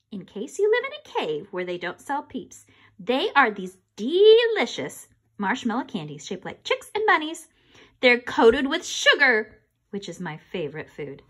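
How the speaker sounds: ordinary delivery, nothing marked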